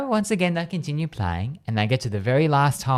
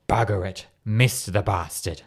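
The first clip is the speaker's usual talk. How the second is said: deep heavenly voice; 4 semitones lower than usual. Acted. Voice from the heavens yelling at a missed strike, mock deep voice. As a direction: lower your voice into a hammy voice from above. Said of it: "the deep, loud voice from the heavens".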